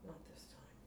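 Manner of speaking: breathy inner vow; hushed, to herself; quiet determined thought; whispered resolve. Acted.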